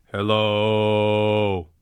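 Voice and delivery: very low hello, hold out the O; low held hello. Shown as a demonstration. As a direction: rumble a long low hello. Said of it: "hold out the O sound".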